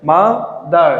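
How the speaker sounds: "Mother" in two beats, ma, dar; split into syllables. Shown as a demonstration. Teacher giving a two-syllable example, "mother" split ma, dar. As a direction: pause inside "mother" between ma and dar. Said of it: "দুটি অংশ থাকে".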